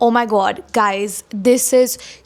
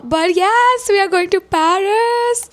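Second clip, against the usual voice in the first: higher, excited announcement; 8 semitones higher than usual. Real, not acted. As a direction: burst out with the good news again. Re-announcing the trip.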